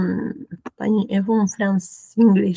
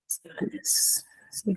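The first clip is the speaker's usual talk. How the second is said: whisper; 11 dB quieter, slower than usual. Real, not acted. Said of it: "to herself".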